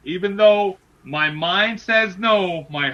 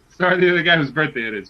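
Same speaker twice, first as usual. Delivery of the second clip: usual speaking voice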